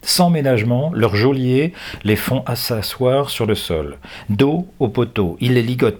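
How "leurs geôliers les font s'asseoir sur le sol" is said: ordinary delivery, nothing marked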